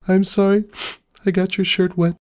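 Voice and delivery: high, teary murmur after sobbing; a small apology, worn out from crying; mutters. A narrator's reading. Pinkie apologises to Maud after crying, high and tearful. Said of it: "mutters Pinkie Pie".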